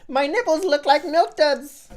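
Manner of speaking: high, loud quoted line through laughter; laughing movie quote. Retold or imitated. Quoting a favourite comedy to his friend, high and laughing. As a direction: squeeze the silly line out high, cracking up.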